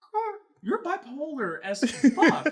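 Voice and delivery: high, loud and drawn out, amused; exasperated mock insult. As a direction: hurl it slowly with a laugh in the voice. Retold insult in the quarrel.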